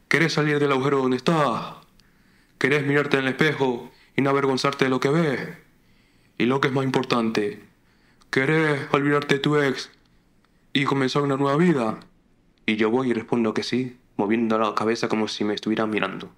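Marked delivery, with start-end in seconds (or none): 0.1-12.0 Argentine psychoanalyst. Acted. Online psychoanalyst offering help to a lost viewer, Argentine guru voice. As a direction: pitch it up like an Argentine self-help preacher. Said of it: "A un psicoanalista argentino casposo".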